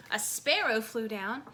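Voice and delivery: usual speaking voice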